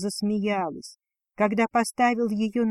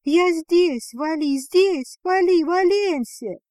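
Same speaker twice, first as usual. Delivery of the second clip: high, loud excited shouts; stretched, high, ringing calls; loud calling out; 7 semitones higher, 6 dB louder, slower than usual. A narrator's reading. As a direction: shout to a friend running your way. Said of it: "громко выкрикивая".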